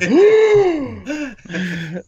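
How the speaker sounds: loud, high mock gasp; imitated audience gasp. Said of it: "So it's, the audience, like".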